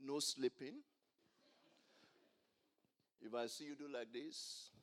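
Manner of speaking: normal talking voice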